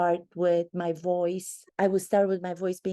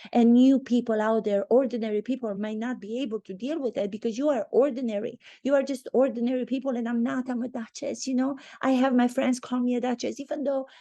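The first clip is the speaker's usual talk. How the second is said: higher, haughty mock voice, fast toward the end; mocking a snobbish duchess; 4 semitones higher, faster than usual. Mocking Meghan as a lofty duchess talking down to ordinary people. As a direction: send up her superiority, lifting the pitch. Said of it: "by saying".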